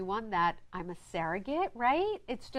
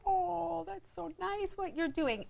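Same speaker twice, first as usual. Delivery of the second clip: high, cooing mock approval; put-on sweet admiration, pitched up; imitated admirers; 9 semitones higher, 3 dB quieter, slower than usual. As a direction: gush like impressed acquaintances. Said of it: "everybody would always be like".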